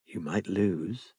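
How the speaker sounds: soft and slow, hushed; a quiet, slow warning, close and low; softly spoken tease. Acted. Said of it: "Robin said softly".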